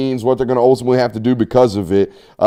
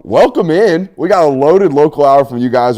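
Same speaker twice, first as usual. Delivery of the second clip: upbeat podcast host welcome; show host intro; 5 semitones higher, 8 dB louder than usual. Acted. Host opens local hour segment.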